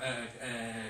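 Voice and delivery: normal talking voice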